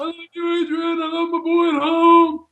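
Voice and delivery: hammed-up boxer calling out to loved ones, high; high, strained mock-emotional cry; Rocky Balboa. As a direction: holler it like a worn-out champ. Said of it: "Rocky Balboa single-handedly beats".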